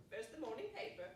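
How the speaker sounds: normal talking voice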